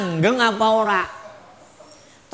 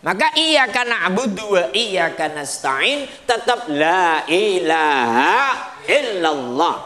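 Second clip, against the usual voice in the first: normal talking voice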